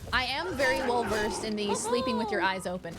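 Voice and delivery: ordinary delivery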